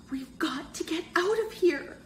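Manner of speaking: high, lightly breath-filled stage whisper; urgent whisper. A narrator's reading. Sherry urging her friends to escape the basement, urgent high stage whisper. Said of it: "Sherry whispered".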